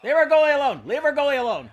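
high, loud yell at the screen; loud protest. Real, not acted. Gamer yelling to protect his goalie, loud.

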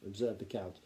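ordinary delivery, nothing marked

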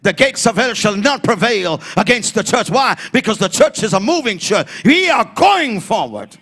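ordinary talk

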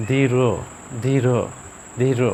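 ধীর with a light pull of h at the end; aspirated final R. Shown as a demonstration. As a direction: repeat ধীর separately, releasing air on the last sound. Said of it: "হয়ের একটু হালকা একটু".

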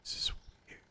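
breathless whisper. Real, not acted. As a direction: whisper it to yourself, spooked. Hushed aside while spooked.

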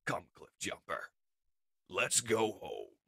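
low, soft, slowing command; deep, subdued call to go home; weary leader. Acted. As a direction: say it low and quietly to a comrade. Leader quietly calls comrade home.

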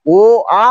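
ও and আ as two separate held vowels; two-syllable demo. Shown as a demonstration. Teacher showing students two syllables, sounded out separately. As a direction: say ও, pause, then আ. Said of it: "দুই অক্ষরের উচ্চারণ".

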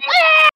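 shrill high-pitched shriek; high scream. Acted. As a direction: shriek as you run. Player screams while fleeing.

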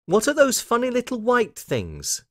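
put-on very high, curious little girl; Lucy. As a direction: ask with childish wonder, voice right up. Lucy asks about odd laundry.

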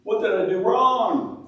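mock whining, pitched high and dragging on the big cries; self-pitying wail. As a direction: throw a hammed-up pity party. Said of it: "we'd be having a pity party".

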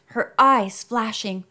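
ordinary delivery

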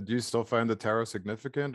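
ordinary talk

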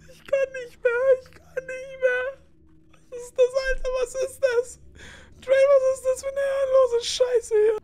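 high, near-crying whine; panicked wail, close to tears; whimpering panic. Real, not acted. Scared player near tears.

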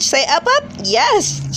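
high, loud cheering. Real, not acted. Cheering baby on.

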